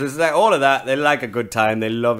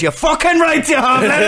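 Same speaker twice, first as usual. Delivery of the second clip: much higher, louder mock yell; hammed-up angry dad shouting; mock furious dad; 8 dB louder than usual.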